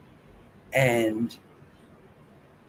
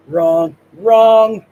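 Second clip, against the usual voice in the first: louder, higher, hammering each word; loud insistence; 4 semitones higher, 10 dB louder than usual. Real, not acted. Venting at the state of politics, loud emphatic repeat. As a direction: bang the word down twice. Ranting about everything being wrong.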